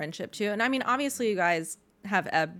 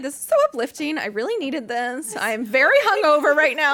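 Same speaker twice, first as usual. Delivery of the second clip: high, loud, gushing; overjoyed and grateful; excited; 8 semitones higher, 10 dB louder than usual. Retold or imitated. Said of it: "I was like".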